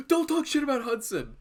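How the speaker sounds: high, loud, put-on outrage; mock indignant fans. Streamer mimicking fans defending Hudson, mock indignation. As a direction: jump hotly to his defence, hammed up.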